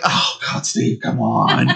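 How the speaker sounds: mock exasperated groan, drawn out; slow, low groan of disbelief. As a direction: drag it out in weary disbelief. Exasperated reaction to Steve's dropped question.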